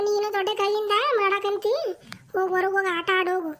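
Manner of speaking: normal talking voice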